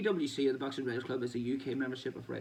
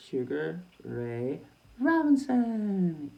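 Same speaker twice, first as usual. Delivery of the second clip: slow, stretched announcer call, the last name pitched much higher and louder; ring announcer call; 5 semitones higher, 6 dB louder, much slower than usual. Pretend. Answering his own quiz question, a drawn-out ring announcer. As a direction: drag out the name like introducing a legend.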